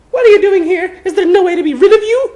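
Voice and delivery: put-on high, loud hurt girl voice; hurt and humiliated schoolgirl; upset Hermione.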